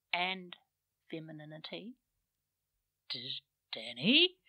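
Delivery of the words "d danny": hesitant, stammering question; tentative. A narrator's reading. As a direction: ask it unsure and halting. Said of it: "he asked tentatively".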